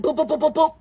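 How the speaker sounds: quick string of boops; playful sound effect of scurrying off; imitated boop sounds. Storyteller going straight to the back, playful boops.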